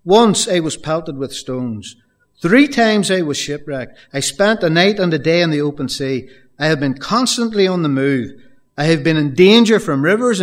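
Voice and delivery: fervent preacher listing hardships; preaching at full force. Acted. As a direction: thunder each danger to the congregation.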